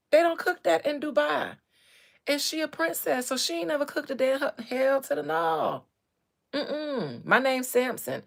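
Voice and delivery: husband scolding those who set him up. Retold or imitated. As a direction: keep the outrage up, scolding. Continued character rant.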